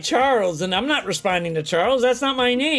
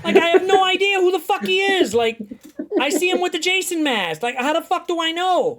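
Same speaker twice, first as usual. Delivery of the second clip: higher, half-laughing disbelief all through; exasperated rant; 9 semitones higher, 4 dB louder than usual. Retold or imitated. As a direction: protest you couldn't possibly know, hammed up. Retold indignant self-defense rant.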